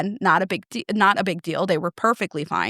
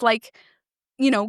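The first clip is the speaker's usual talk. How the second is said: usual speaking voice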